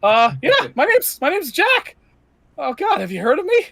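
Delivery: put-on jumpy nervous young man rambling; nervous young Jack. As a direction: play Jack as an anxious, eager twenty-something. GM voices nervous NPC Jack.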